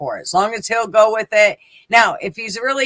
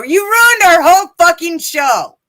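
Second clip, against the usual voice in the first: much higher, loud mock rant; mock angry Jank; 10 semitones higher, 8 dB louder than usual. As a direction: blow up at the whole chat as Jank. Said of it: "Jank's gonna go".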